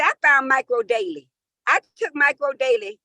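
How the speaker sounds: ordinary talk, nothing marked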